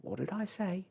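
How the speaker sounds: high-pitched gloating bird; rude shrieking starling. A narrator's reading. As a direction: crow it rudely in triumph. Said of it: "shrieked the starling rudely from the window".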